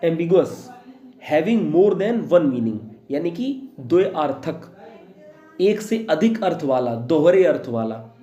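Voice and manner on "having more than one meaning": each word spaced out slowly for students to write; a teacher reading a definition aloud to be copied down, slowly; slow dictation. Shown as a demonstration.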